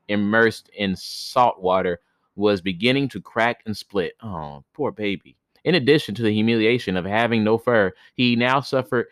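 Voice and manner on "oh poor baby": quiet tender sympathy; soft pity. Real, not acted. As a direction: coo it gently. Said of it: "pity for the hare".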